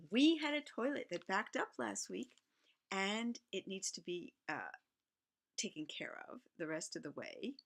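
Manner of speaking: ordinary delivery, nothing marked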